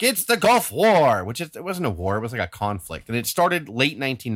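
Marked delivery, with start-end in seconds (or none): 0.0-1.2 high, loud, drawn-out mock headline; hyped-up media. Podcaster mocking media coverage of the conflict, in hyped-up voice. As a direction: announce it slowly like breathless news hype. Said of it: "the media is like".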